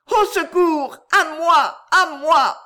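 loud, high cries of alarm; calling for help. A narrator's reading. Girl in the boat on a deserted road, calling for help. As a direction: shout desperately for rescue. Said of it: "se mit à appeler au secours".

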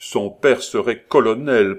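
loud, proud pronouncement; offended dignity. A narrator's reading. Young lover vaunting her father's rank, dignified. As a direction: declare it with lofty dignity. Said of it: "prononça Roland avec dignité".

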